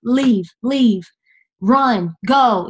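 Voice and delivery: lightly voiced insistent tempter; urging devil. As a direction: urge it in short, pressing commands. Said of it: "the enemy was at".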